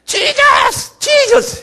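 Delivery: very high, loud mimicked cries; imitated prayer shout. Preacher imitating African worshippers who learned the triple cry, shouting. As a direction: shout the name as a worship chant. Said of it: "아프리카 사람들이 한국에서 주여".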